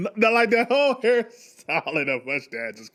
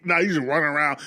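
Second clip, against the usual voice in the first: ordinary delivery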